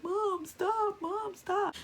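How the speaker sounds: put-on young daughter pleading high; daughter's plea. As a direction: beg mom to stop in a high voice. Daughter voiced pleading in fight.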